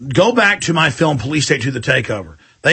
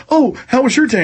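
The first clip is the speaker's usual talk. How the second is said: higher, sugary mock concern; mock flirting anchor; 8 semitones higher than usual.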